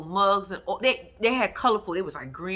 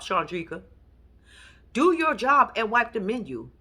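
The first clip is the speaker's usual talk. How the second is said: ordinary delivery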